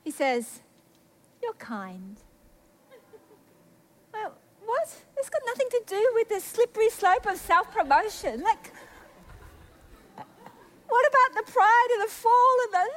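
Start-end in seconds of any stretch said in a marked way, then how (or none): 1.4-2.2 low, soft, calm; gentle reply. Retold or imitated. God's calm answer after her rant. 4.1-12.9 high, incredulous objection; baffled protest. Retold or imitated. Baffled protest at God's answer.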